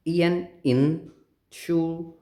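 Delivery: very slow entschuldigung, split into small chunks; teacher splitting entschuldigung for learners; broken into parts. Shown as a demonstration. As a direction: break the word into pieces slowly. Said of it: "छोटा पढ़ो".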